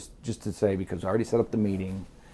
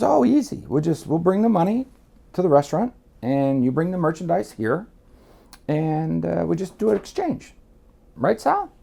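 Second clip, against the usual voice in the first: higher-pitched imitation of an easygoing buyer; breezy buyer; 8 semitones higher than usual. Buyer explaining the diamond exchange, breezy imitation. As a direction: make it sound simple, pitched up.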